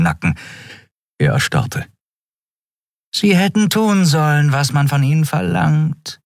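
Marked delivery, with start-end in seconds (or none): 3.1-6.2 much higher, silky purr; purring traitor. A narrator's reading. Traitor purring behind the admiral's back, silky and smug. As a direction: purr it sweetly, savouring the betrayal. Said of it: "schnurrte Shiar".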